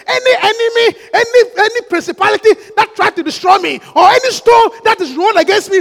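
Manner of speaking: forceful shouted declarations and tongues; warfare prayer. Shown as a demonstration. Preacher praying against enemies of a marriage, driving shout. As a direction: declare each line like a battle command.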